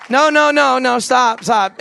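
stopping the crowd. Real, not acted.

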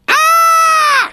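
sudden high shriek; mimicking a woman's shriek; retold scream. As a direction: let out a piercing cry. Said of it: "She screams out loud like that".

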